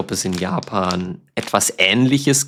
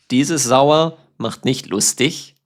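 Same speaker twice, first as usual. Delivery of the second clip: normal talking voice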